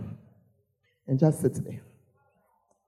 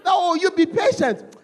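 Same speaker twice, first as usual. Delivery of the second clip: tearful mother imitation; 9 semitones higher than usual. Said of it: "Your mother will be crying".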